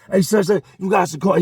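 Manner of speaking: loud, high call; yelling around. Retold or imitated. Trap yelling around the pod for coffee, loud. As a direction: holler for coffee at anyone. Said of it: "He starts yelling around".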